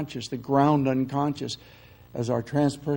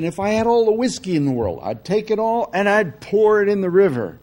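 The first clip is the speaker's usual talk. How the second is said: louder, higher preaching climax; final emphatic cry; 6 semitones higher, 8 dB louder than usual. Retold or imitated. Southern minister ending his sermon with the loudest vow. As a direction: deliver the last line like thunder. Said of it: "he said, finally".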